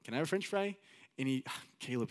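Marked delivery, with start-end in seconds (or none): 0.0-0.8 higher-pitched imitated Mexican accent; Mexican-accented grandpa asking nicely; grandpa's Mexican accent. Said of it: "he had an accent".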